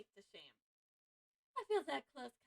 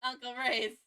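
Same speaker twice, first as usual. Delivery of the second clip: laughing shout; 10 dB louder, much slower than usual. Real, not acted. Shocked reaction, laughing.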